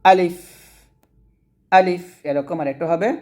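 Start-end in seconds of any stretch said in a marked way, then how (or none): 0.0-2.0 আলিফ said short, no drawing out; quick alif. Shown as a demonstration. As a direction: clip আলিফ quickly without stretching. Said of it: "আলিফটা একটু তাড়াতাড়ি পড়বেন".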